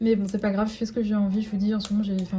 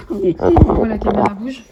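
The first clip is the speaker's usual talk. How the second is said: exasperated; 10 dB louder, slower than usual. Real, not acted. Dog jumping, camera moving.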